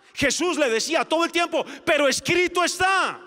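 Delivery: high, loud pulpit proclamation; full-force preaching. Acted. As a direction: thunder "Pero escrito está" each time. Preaching Jesus' answers at full force.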